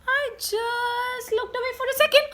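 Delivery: "I just looked away" said fast and high; high, fast storyteller build-up; quick rising suspense. A narrator's reading. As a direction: hurry it like a kid about to reveal.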